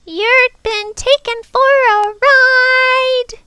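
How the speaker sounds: very high, slow, loud bird screech; squawking bird. Acted. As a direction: squawk it out on one high pitch. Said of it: "shook herself and muttered".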